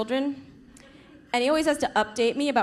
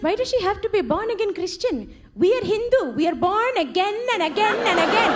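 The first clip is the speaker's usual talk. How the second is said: indignant Indian mom; 8 semitones higher than usual. Retold or imitated. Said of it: "She was like".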